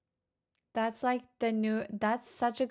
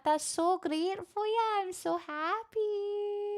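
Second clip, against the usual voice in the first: mock Irish accent, lilting up higher; bad Irish accent; 5 semitones higher than usual. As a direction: gush in a put-on Irish brogue. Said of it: "It's such a great Irish accent".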